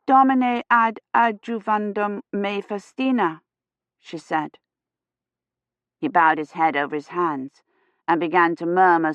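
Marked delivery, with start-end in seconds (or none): 0.1-3.4 slow church Latin prayer. A narrator's reading. Feverish girl prays in church Latin, slow. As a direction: intone the Latin slowly. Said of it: "church Latin".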